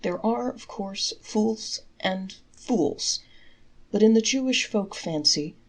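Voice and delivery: plain reading voice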